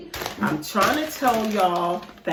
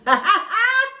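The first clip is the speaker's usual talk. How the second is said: high laugh; 13 semitones higher, 5 dB louder than usual. Real, not acted. Laughing at herself mid-mukbang.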